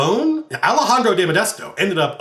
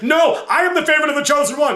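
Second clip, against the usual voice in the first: high, fast, indignant dwarf voice; squabbling crazed dwarf; insane Darrow; 12 semitones higher, faster than usual. Retold or imitated. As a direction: insist jealously, pitched way up. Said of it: "the Darrow who found Darinka is like".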